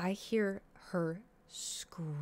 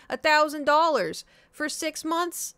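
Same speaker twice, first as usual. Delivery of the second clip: indignant; 7 semitones higher, 5 dB louder, slower than usual. Real, not acted. Indignant over cost.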